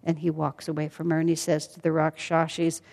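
ordinary delivery, nothing marked

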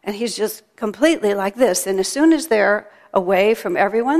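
ordinary talk

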